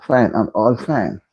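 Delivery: ordinary delivery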